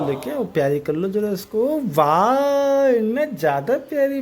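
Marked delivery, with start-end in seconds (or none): 1.8-2.9 long drawn-out wow. Real, not acted. Drawn-out praise for doll.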